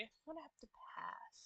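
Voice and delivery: unvoiced whispered refusal. Real, not acted. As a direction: breathe it out as a whisper. Whispered line.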